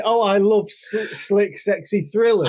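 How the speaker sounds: high, slow mock enthusiasm; mocking quote. Teasing the co-host with their own words, mocking high drawl.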